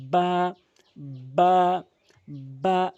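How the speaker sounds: বা said with both lips firmly closed, then released; ba demo. Shown as a demonstration. Quran teacher showing students the letter বা. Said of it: "দুই ঠোঁট মিলিত করে এভাবে উচ্চারণ করবেন".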